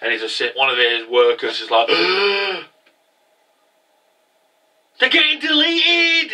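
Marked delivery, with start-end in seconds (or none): 1.9-6.3 high, slow panicked yell; mock panic. Worker warning his boss, hammed-up panicked shout. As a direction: cry out the alarm.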